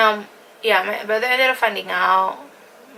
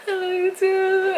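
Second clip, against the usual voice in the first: high, drawn-out mock-weepy voice; squeaky, stretched-out imitation of tearful affection; sentimental, imitated; 12 semitones higher, 4 dB louder than usual. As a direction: exaggerate being overcome with emotion. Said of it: "I'm, like, so sentimental".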